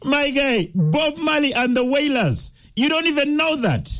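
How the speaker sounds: high-pitched incredulous scolding. Real, not acted. Quiz host to caller who missed [name], incredulous.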